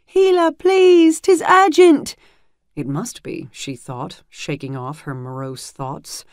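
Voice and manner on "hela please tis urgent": much higher and louder, slow urgent begging; frantic pleading shout. A narrator's reading. A breathless squire begging the healer to open up. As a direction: plead through the door at full volume. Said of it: "that frantic voice shouting".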